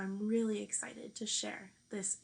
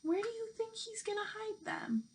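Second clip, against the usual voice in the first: whisper; 11 semitones higher than usual. Real, not acted. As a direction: lean in and whisper the question like a secret. Asks young listeners a secret question.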